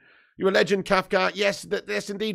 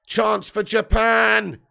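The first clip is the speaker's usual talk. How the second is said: commentator call; 6 semitones higher, 8 dB louder, much slower than usual. Acted. Live football commentary call.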